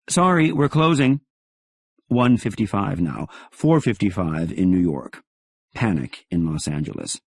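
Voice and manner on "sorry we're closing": brisk clerk. Acted. Clerk hanging up quickly.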